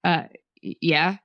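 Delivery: a hint of a startled store worker, stammering; startled employee. A narrator's reading. Caught employee answers a customer at the food bar, startled. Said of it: "The employee jerks backward and looks at me".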